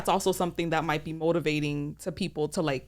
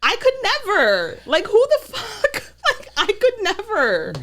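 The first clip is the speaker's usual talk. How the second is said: laughing disbelief; 13 semitones higher, 4 dB louder than usual. Real, not acted.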